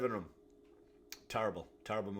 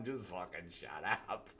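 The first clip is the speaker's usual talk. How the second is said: low muttered snap; 4 semitones lower, 5 dB quieter than usual. Retold or imitated. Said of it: "I'm like".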